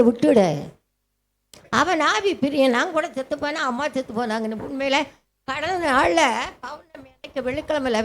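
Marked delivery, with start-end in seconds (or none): none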